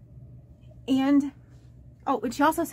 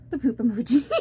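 breaking into real laughter; laughing. Real, not acted. Recalls laughing hysterically at emoji.

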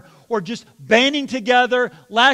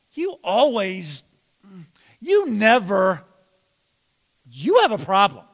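accusing tone; 5 dB louder than usual. Shown as a demonstration. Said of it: "You start with things like".

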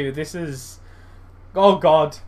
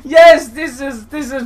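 high, loud yells of joy; joyful shout; 8 semitones higher, 9 dB louder, slower than usual. Real, not acted. Gamer cheering his striker's goal, shouting. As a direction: cheer the finish home.

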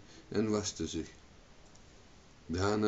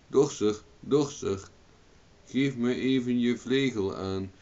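mildly raised calling voice; 4 semitones higher, 4 dB louder than usual. A narrator's reading. Owen calls up to the thresher, mild calling out. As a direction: hail someone overhead, a touch louder. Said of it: "En Owen riep".

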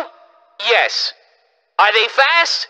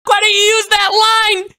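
outraged shouting; 9 semitones higher than usual. Real, not acted. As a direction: shout in shocked protest. Outraged by the Nakama line.

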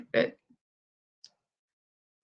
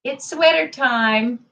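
sing-song excitement; 5 dB louder than usual. Real, not acted.

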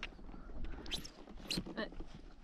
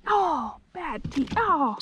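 normal talking voice

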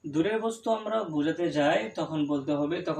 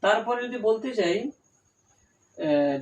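ordinary talk

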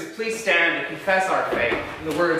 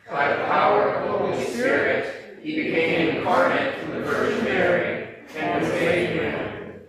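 higher, steady communal recitation; creed recited in unison; 5 semitones higher than usual. Real, not acted. Congregation standing, reciting the Nicene Creed in unison. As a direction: speak the creed together, measured and even.

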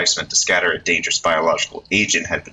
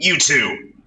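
higher, raised call; 8 semitones higher than usual. Acted. Reporter calling to the two youths, raised call. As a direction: call across to get attention. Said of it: "Minorikawa called out".

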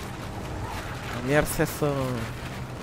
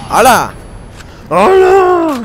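loud high yelling; shouting match; 13 semitones higher, 7 dB louder, much slower than usual. Real, not acted. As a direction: yell it out in disbelief. Yelling at a silent friend.

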